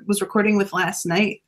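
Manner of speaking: ordinary delivery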